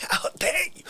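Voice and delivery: mock tearful how dare you. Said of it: "do a little how dare you for him".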